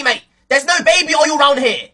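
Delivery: ordinary talk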